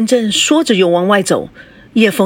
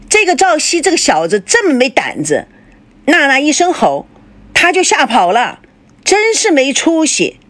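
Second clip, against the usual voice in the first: higher-pitched resentful grumble; resentfully; 8 semitones higher than usual. Acted. Father resentfully scorning the daughter's boyfriend. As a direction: spit it out with resentment. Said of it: "反而恨恨地说".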